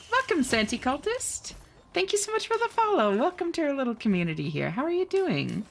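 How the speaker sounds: high, bright cheery welcome; excited greeting. Real, not acted. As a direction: greet them up high and eager. Greeting new follower on stream.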